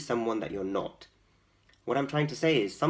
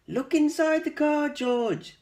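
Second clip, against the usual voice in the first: much higher, louder animal voice; animals' voices; 6 dB louder, slower than usual. Acted. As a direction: coax him eagerly as the animals. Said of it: "said the animals".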